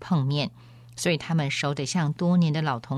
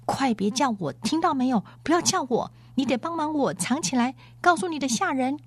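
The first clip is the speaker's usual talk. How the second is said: high, urgent plea, fully voiced; impatient hushing; 8 semitones higher than usual. A narrator's reading. Runaway begging her friend to hide her, impatient and high-pitched. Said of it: "急躁地在他耳边悄声说道".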